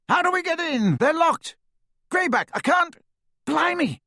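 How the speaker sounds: high, frantic thug voice; a scared, yelping henchman; panicked Snatcher. A narrator's reading. Panicked Snatcher at locked gates.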